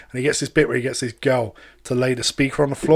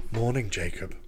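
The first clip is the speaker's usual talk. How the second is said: creepy AI voice; 6 semitones lower, 9 dB quieter than usual. Acted.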